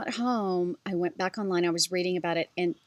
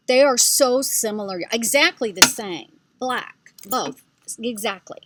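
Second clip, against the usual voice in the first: louder, higher burst of excitement; excited; 4 semitones higher, 7 dB louder than usual. Real, not acted. Telling viewers the two bags match, excited and loud. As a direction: blurt it out, thrilled. Thrilled bags are the same.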